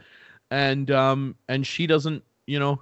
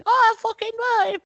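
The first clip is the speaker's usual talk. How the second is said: very high, loud put-on British lady; cranky queen, pitched way up and louder; bad Queen impersonation; 5 dB louder than usual. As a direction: screech it like a fed-up royal. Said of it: "That's my bad, like, uh, impersonation".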